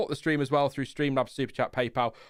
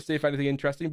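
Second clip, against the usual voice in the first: ordinary talk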